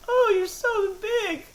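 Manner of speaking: high, slow falsetto woman's voice. Pretend. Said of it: "the women's voice".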